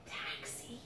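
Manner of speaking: soft breathy whisper of the word. A narrator's reading. Parent reading to kids, whispering the last word. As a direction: drop to a whisper.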